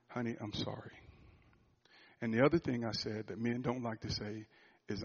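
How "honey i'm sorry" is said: much softer, hushed apology; barely above a breath, humbled; soft confession. Retold or imitated. Confessing fear to his wife.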